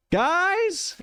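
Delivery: very high, drawn-out distant call. Acted. Alia calls across the grand room, calling out. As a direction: call to friends in a squeaky far-off voice. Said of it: "you hear Alia's voice call out".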